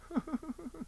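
a quick high laugh; short laugh. Real, not acted. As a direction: laugh at your own story. Laughing at own story.

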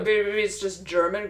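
a hint of mock German shouted; fake German. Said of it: "Oh, a fake German there".

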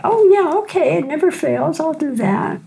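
mock eager agreement. Agreeing to friend's invitation, in mock eager delight. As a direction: chirp a bright, breezy yes. Her own eager reply retold.

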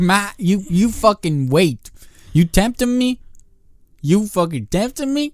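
put-on high, slow menacing kid voice; a toddler growling threats at his mother; high mock-threatening kid. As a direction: threaten your mom like a tiny gangster. Voicing kid threatening mom.

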